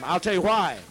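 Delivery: P=A; loud warning. Real, not acted. Warning the congregation.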